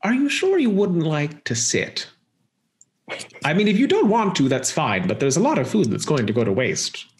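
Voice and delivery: high, lilting offer easing down; theatrical hostess, mock-polite; coaxing villainess. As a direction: coax them like an overly gracious hostess. Game master plays the villain.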